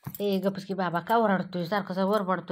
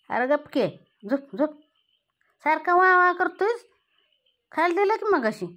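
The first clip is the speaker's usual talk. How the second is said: raised, higher voice scolding the kitten to hush; sharp raised telling-off; loud scolding; 7 semitones higher, 9 dB louder than usual. Real, not acted. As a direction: shoo the noisy kitten off, loud.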